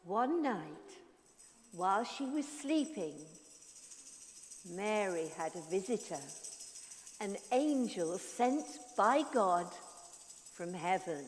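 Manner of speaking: soft storytelling voice; story narration. A narrator's reading. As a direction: tell it gently to children.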